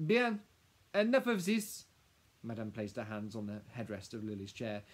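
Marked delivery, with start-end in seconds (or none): 0.1-1.9 high, loud French-tinged command; imperious. Acted. Madame halting the lawyer and professor, imperious. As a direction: shut the discussion down sharply.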